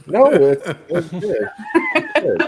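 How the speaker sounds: high, giggly reassurance; laughing reassurance. Real, not acted. Friend reassuring the embarrassed speaker, laughing and high-pitched. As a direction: reassure through bubbling laughter.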